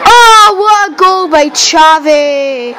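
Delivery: slow, drawn-out commentator call of the goal; mock commentary.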